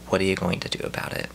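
ordinary delivery

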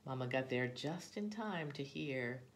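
plain reading voice

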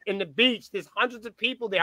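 normal talking voice

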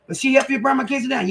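high, loud demanding mom voice; scolding mom. Retold or imitated. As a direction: play the angry mama catching the kid. Mom scolding child over burnt kitchen.

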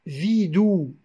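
"vidou" with the mute E elided into the next vowel; "vidou", liaison poétique sans E; poetic linking. Shown as a demonstration. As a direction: say "vidou", vide gliding straight into où. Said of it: "Alors on va dire".

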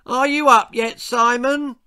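high, loud call from upstairs; loud sing-song call from the loft. A narrator's reading. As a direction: call up through the house to wake him. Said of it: "his mum cried out from the loft".

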